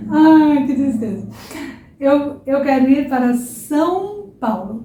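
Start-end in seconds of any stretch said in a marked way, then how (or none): none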